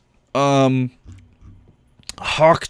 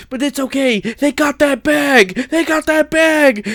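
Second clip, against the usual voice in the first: mock whiny excuse, climbing higher and higher; mocking bag defender; 5 semitones higher than usual. Ranter imitating people who excuse fame-chasers, mockingly. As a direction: build a sarcastic imitation up to a high-pitched peak. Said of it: "this is why I hate the phrase".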